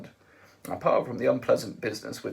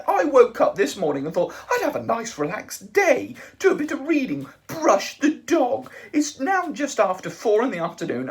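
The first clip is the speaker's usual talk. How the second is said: raised, loud and indignant; spluttering Arthur; 12 semitones higher, 8 dB louder than usual. A narrator's reading. Arthur to the Vogon, held by the throat, spluttering indignantly. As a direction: sputter it out in outrage. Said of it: "he spluttered and gurgled".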